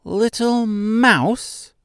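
very high, slow squeak of a tiny queen; high squeaky mouse. A narrator's reading. Mouse queen correcting Mister, high squeaky voice. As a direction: squeak it out like a proud little mouse. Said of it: "she replied in a high-pitched, squeaky voice".

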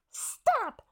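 distant angry shouting; muffled yell. Retold or imitated. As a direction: yell it from far off. Retold actor yelling from afar.

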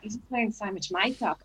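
soft, mock Australian remark; Australian accent. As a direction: attempt an Australian accent, quietly. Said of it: "that was my Australian accent".

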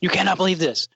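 like a kid in a candy store, playfully imitated; giddy. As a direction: mimic someone bursting with giddy disbelief. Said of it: "like, giddy".

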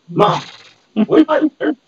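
high, loud call to mom; yelling across the room. Retold or imitated. Kid calling mom from another room.

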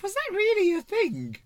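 high, mock-puzzled wondering; incredulous inner voice. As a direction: act out baffled lunch planning. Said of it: "in my head, I'm like".

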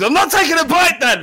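put-on high, loud teenage outburst; mock tantrum. Son refusing his dad's spag bowl, high mock yell. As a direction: yell it like a sulking kid. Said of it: "And he goes".

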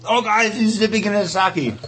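mock alarm. Teasing alarm at friend.

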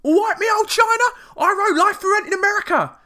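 high, loud exaggerated Cockney; Cockney accent. Host mocks the singer's interview in hammed-up high Cockney. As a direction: ham up a chirpy Cockney impression. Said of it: "much more Cockney voice than you may imagine".

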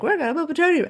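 high-pitched mimicked line; squeaky-high quote of the characters; mock movie character. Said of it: "they're all like".

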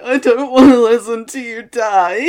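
loud, high, laughing half-sob; laughing through mock tears. To the co-star after a near-death scene, a laughing sob. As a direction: wail it while laughing at yourself. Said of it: "And I'm like".

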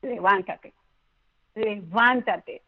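levántate repeated slowly, the second one drawn out; slow emphatic levántate. Shown as a demonstration. Urging the host to rise again, slow emphatic levántate. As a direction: urge the listener with slow weight on levántate. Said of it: "yo te digo hoy".

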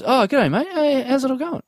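high, breezy matey hello; chummy greeting. Retold or imitated. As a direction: greet like an old mate, pitched right up. Acting contestant greeting him.

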